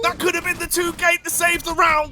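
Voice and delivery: high, quick play-by-play call; esports caster. Acted. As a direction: call the lost save.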